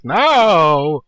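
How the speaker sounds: loud, high mock protest; sudden hammed-up no; mock shout. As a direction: yell it like a panicked friend. Friend protesting in chat log.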